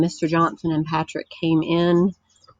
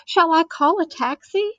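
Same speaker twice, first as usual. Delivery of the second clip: much higher shocked question; 11 semitones higher than usual. A narrator's reading. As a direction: still reeling, offer the taxi. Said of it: "in a shocked voice".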